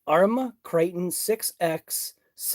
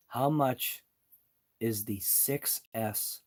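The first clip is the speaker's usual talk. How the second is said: slow and careful; 5 semitones lower, 6 dB quieter, slower than usual. Shown as a demonstration. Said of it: "Maybe I should say".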